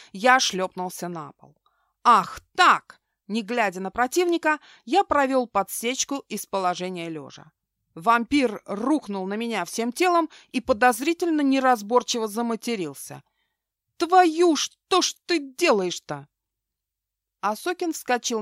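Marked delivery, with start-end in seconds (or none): 2.0-3.0 loud defiant exclamation; loud outburst. A narrator's reading. Hero kicks back in fight. 14.0-16.3 high loud angry yelp; pained outcry. A narrator's reading. Partner kicked, cursing.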